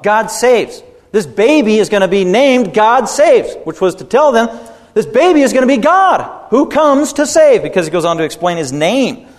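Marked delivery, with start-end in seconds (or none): 0.0-7.6 climbing higher and louder into full preaching force; building preaching. Acted. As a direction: build it up like a preacher hitting the climax.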